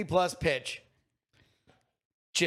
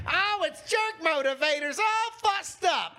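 squeaky voice, much higher; 12 semitones higher than usual. Retold or imitated. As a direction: squeal it out high and giddy. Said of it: "and she'd be like".

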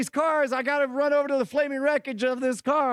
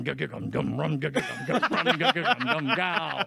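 vocal drumbeat; 7 semitones lower than usual. Retold or imitated. Imitating drumbeat music.

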